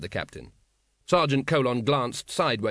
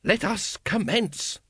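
higher, insistent assurance; snappish; 7 semitones higher than usual. A narrator's reading. Grand Master overruling a doubter, snappish. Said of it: "snapped the Supreme Grand Master".

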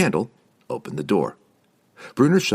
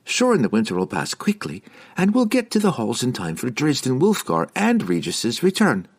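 higher, reassuringly upbeat; stubbornly cheerful; 6 semitones higher than usual. A narrator's reading. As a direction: cheer him up and don't give in. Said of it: "stubbornly holding her cheerful tone".

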